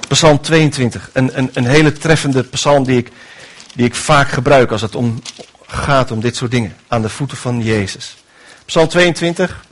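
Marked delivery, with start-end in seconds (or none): none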